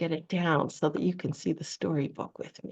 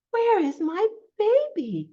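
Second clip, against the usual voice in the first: high, louder mother-hen question; clucking chicken; 11 semitones higher, 5 dB louder than usual. Acted. Storyteller voicing a hen looking for her chick, clucking chicken. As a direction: call out high as a worried hen. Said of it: "clucks the chicken".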